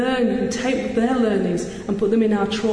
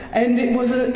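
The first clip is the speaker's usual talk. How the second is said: ordinary delivery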